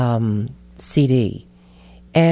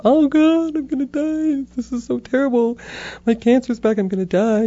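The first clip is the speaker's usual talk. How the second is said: high-pitched mock wail of despair; overblown, self-mocking doom; melodramatic panic; 10 semitones higher than usual. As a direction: wail it out, hamming up the dread. Acting out her panic at cancer's return.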